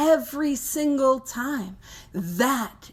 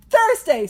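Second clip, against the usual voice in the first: THURSDAY said higher and louder, fixing the slip; emphatic correction; 9 semitones higher, 7 dB louder than usual. Real, not acted. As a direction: snap the right day out. Correcting the wrong day, emphatic.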